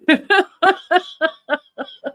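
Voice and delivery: high burst of laughter; loud laugh. Real, not acted. Laughing at Musk story.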